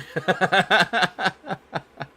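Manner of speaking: real loud high burst of laughter; cackling laugh. Real, not acted. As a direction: laugh hard at the joke. Laughing at own joke.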